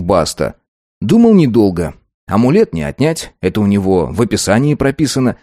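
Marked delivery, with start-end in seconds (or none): none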